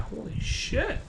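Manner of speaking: hushed, awestruck mock reaction; retold awe. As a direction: replay being quietly stunned. Acts out amazement at glue fix.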